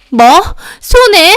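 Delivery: high, loud outraged echo; outraged disbelief. A narrator's reading. Youngest sister-in-law hears of the other's loss, outraged. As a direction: explode on hearing the word. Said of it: "눈이 확 뒤집히더니".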